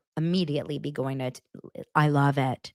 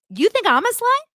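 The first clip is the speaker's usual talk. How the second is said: high, loud indignant squeal of a question; mock outraged Angie; 6 dB louder than usual. Recapping the reality fight, a mock outraged Angie. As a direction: camp it up as an offended friend. Angie confronting, quoted shrilly.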